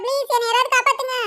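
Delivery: a mild, hammed-up plea for rescue; pleading for help. Meteor about to fall. (லேசான நடிப்பு கெஞ்சல்)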